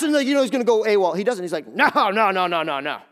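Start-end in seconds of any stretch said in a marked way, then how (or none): 1.7-3.0 hasty denial. Acted. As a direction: rattle off denials, loud then dropping. Acting Peter's panicked denial.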